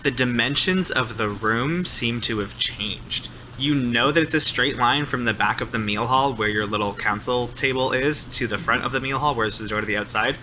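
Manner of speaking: normal talking voice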